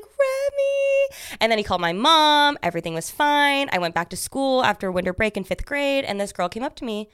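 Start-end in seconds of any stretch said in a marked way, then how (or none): 0.0-1.2 high, stretched call of Remy; dad calling out. Retold or imitated. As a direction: call her name from afar. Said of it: "He's like".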